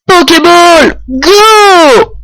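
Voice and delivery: loud high battle cry; shouting. Acted. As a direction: call it out like a trainer. Throwing a Pokéball, battle cry.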